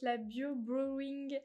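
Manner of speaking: brand name in an English accent, slow. Real, not acted. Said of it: "excusez-moi pour l'accent anglais". (avec l'accent anglais sur « Bio Brewing », lentement)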